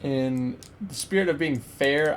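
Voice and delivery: ordinary talk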